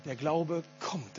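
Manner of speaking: leise und langsam auf „kommt“ hinführen; „kommt“ gedehnt und eindringlich, ganz leise; Betonung auf „kommt“. Shown as a demonstration. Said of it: "das ist ganz wichtig". (leise, langsame Betonung von „kommt“)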